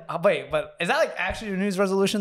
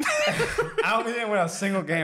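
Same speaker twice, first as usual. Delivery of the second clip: 1 laughing; 4 semitones higher, slower than usual. Real, not acted. Laughing about losing season.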